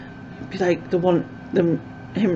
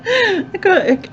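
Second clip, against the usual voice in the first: laughing; 4 dB louder than usual. Real, not acted. Laughing at own failed accent.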